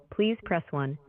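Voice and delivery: ordinary talk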